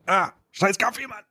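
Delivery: strained voice between coughs; choking on coffee. Real, not acted. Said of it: "ich hab mich verschluckt". (verschluckt und heiser)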